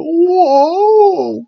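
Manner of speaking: long, high held whoa; imitated amazed whoa. Imitating the wrestlers' reaction, long high mock whoa.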